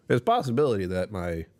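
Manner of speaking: normal talking voice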